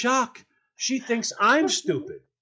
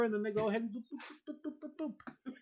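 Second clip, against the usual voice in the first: beeps; 3 semitones higher, 14 dB quieter, much faster than usual. Retold or imitated. Imitating button beeps.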